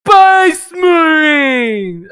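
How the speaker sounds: big slow bellowed shout, high and loud; drawn-out booming ogre call; bellowing ogre. Acted. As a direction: roar it out like a huge brute. GM voices bellowing Ogryn.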